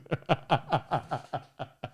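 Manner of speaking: long run of chuckles; helpless, bubbling laugh; real laughter. Real, not acted. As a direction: break up laughing. Cracks up mid-sentence.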